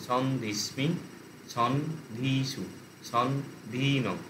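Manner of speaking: "সন", pause, then "ধীসু"; broken into syllables. Shown as a demonstration. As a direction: set "সন" apart from each ending like "ধীনম". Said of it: "ওটাকেও syllable ভেঙে নিতে হবে". ("সন ... ধীসু" ভেঙে ভেঙে)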